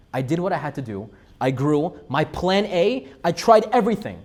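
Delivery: impassioned imagined plea, peaking loud; building exasperation. Acted. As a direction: build from firm to loud, high protest. Acting Akiva's despair, building in force.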